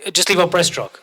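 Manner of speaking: mock coach call. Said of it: "the coaches go".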